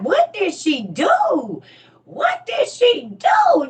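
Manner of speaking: high, loud, slow shouted question; retold screaming. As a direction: scream it out in disbelief. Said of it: "just screaming".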